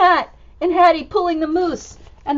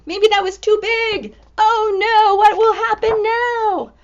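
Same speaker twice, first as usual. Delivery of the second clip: worried Hattie; 6 semitones higher, 7 dB louder, slower than usual. Acted. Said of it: "Hattie down here is like".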